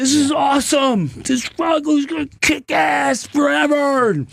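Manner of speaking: cheering his wife on with forced high enthusiasm; put-on excitement. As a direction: shout fake optimism. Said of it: "you did go with it".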